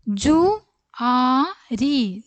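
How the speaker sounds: ജുആരി stretched out slowly; slow drill. Shown as a demonstration. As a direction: sound out ജുആരി for the class. Teacher slow model word. (ജുആരി പതുക്കെ നീട്ടി)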